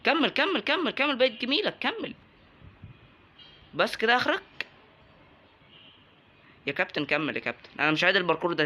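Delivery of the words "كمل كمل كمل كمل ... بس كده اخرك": high-pitched egging on; urging the character higher, pitch up; cheering on. Real, not acted. Cheering his character on climbing.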